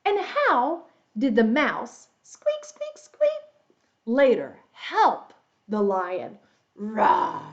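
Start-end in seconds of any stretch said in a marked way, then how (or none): 2.2-3.4 tiny, very high squeaks; mouse squeaks. Pretend. Storyteller plays the little mouse for young listeners, high squeaking. 6.8-7.4 drawn-out play lion roar. Pretend. Storyteller plays the lion for young listeners, drawn-out roar.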